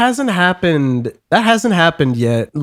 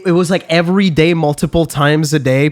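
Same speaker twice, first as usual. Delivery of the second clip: usual speaking voice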